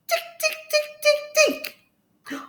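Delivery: very high squeaky monkey ticks; tiny staccato squeaks, mimicking monkeys; monkey chatter. A narrator's reading. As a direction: chirp it like a cheeky little monkey. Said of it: "the monkeys ... and said".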